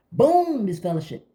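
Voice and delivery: sudden high loud BOOM. Pretend. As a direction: slam it down like a verdict. Mock judging God.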